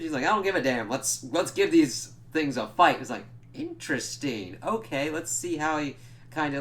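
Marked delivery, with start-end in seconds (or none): 0.2-3.0 brash retold Cap. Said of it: "a little bit looser with his language".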